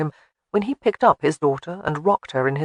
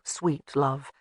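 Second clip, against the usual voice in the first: plain reading voice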